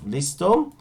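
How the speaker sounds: ordinary talk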